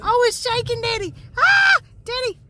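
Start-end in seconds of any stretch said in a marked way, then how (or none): 1.4-1.8 short high, loud scream; a quick shriek of fright; startled scream. Real, not acted. Child to daddy as the ride shakes, startled scream.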